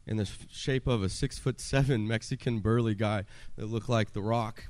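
ordinary talk

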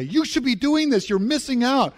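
high-pitched, insistent exhortation; imitating an impassioned enthusiast; passionate urging. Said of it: "She is so passionate about this".

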